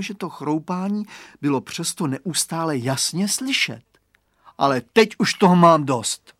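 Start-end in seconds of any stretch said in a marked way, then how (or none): 4.6-6.3 put-on loud boyish outburst; exasperated. A narrator's reading. Boy fed up with the mystery crunching, exasperated. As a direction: lose patience, raising the volume. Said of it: "rozčílil se Radovan".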